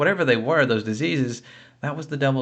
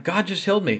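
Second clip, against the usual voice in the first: higher, bursting with joy; excited exclaiming; 7 semitones higher, faster than usual. Retold or imitated. To everyone in Walmart, a joyful high exclaim. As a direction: blurt the good news up high. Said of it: "go around yelling to everyone".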